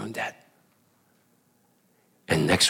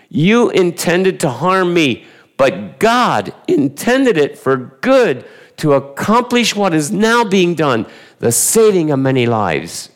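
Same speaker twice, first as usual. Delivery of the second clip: solemn Joseph; 9 semitones higher, slower than usual. Acted. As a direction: declare it slowly, like a gracious ruler. Joseph's solemn reassurance to brothers.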